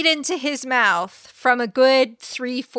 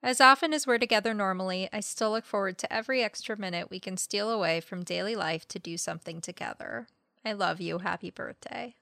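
tender reading; 3 semitones lower, 7 dB quieter, faster than usual. Acted. Reads the heartfelt message.

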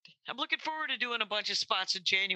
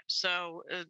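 usual speaking voice

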